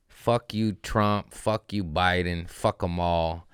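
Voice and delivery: normal talking voice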